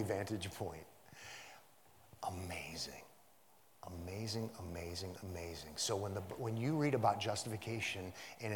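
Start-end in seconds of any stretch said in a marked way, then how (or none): 2.3-5.7 low, soft murmur of amazement; hushed awe. Real, not acted. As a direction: marvel under your breath. Marvels quietly at the text.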